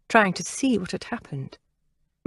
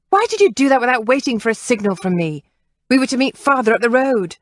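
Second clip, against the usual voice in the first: loud, fast, carrying reprimand; shrill scolding; 5 semitones higher, 6 dB louder, faster than usual. A narrator's reading. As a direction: take the men to task sharply. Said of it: "her voice shrill and carrying".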